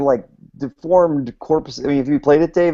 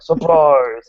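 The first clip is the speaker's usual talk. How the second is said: louder goofy monster call; silly monster voice; 5 semitones higher, 4 dB louder than usual. Retold or imitated. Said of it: "with increasingly silly monster voices like".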